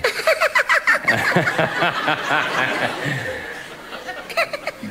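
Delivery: long, high stagey laugh; overdone laugh at a witty story; hammed-up laughter. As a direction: laugh on and on, theatrically. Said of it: "They laughed".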